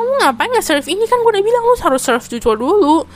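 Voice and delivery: high, loud mock telling-off; retold scolding. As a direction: imitate an exasperated coworker nagging. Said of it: "ngomelin lagi".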